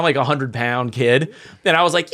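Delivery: ordinary talk, nothing marked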